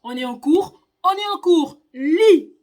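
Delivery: loud, rising scold from the teacher; teacher's scolding shout. Retold or imitated. Teacher yells at pupil to read, loud scold. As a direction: bark it at the pupil like a furious teacher.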